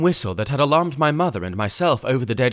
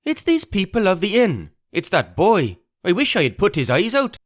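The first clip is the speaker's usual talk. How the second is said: high, vicious raging voice; angry cry; 9 semitones higher than usual. Acted. Pugh rages about the boy to his men, angry. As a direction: spit the threat out. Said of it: "cried the blind man Pugh".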